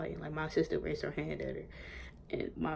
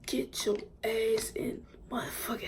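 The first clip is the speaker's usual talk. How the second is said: hissed mom quote; 6 semitones higher than usual. Retold or imitated. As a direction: hiss it at your kid from the car. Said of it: "And she's like".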